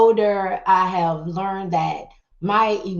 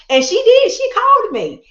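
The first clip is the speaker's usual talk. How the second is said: laughing through the words, higher and louder; 13 semitones higher, 8 dB louder than usual. Real, not acted. Telling a friend how she finally called, laughing. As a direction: laugh it out in delight.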